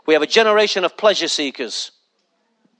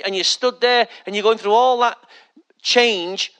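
usual speaking voice

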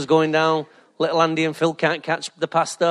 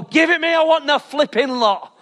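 higher, eager grabbing tone; mock greedy demand; 8 semitones higher than usual. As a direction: demand it all, half joking. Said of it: "you go".

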